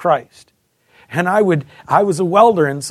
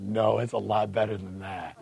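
laughing; 10 semitones lower, 9 dB quieter than usual. Retold or imitated. Laugh heard on line.

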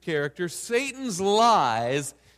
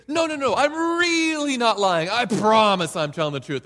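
loud, higher protest; pleading denial; 4 semitones higher, 7 dB louder than usual. Retold or imitated. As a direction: insist desperately you're honest. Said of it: "And then you're gonna say".

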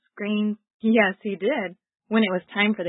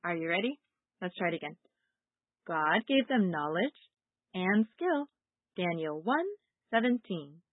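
normal talking voice

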